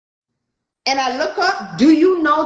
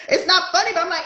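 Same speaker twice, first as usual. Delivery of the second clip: normal talking voice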